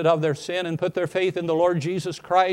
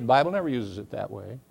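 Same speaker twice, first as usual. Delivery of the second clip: ordinary delivery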